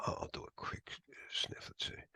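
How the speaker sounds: whisper. Real, not acted. Lecturer starting a packet capture, murmuring to himself in a whisper. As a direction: drop to a breathy self-talk whisper.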